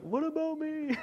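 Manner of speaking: very high, put-on little boy's plea; dad imitating his five-year-old son feeling forgotten; whiny little boy. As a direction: squeak it out like a small son left out. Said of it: "he was like".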